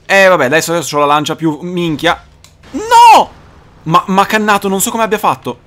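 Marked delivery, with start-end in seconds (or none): 2.7-3.4 sudden high cry of dismay; shocked shout. Real, not acted. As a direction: yell it out in shock. Boss fight goes wrong. (urlo improvviso)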